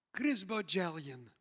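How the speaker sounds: lightly voiced Israeli therapist, low and blunt; a hint of Israeli accent, spelling out his full name; imitated Israeli accent. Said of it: "She said to me".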